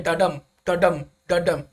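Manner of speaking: "Da dum" with the beat on dum each time; iambic rhythm demo. Shown as a demonstration. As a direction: tap out "da dum" like an iamb, stressing dum. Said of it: "the rhythm of the iambic pentameter".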